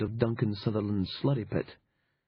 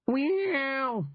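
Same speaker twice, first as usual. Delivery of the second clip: a much higher, long drawn-out meow; cat meow. Acted. Cat kicked through the doorway, high drawn-out meow. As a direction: yowl it like a cat. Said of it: "said the cat".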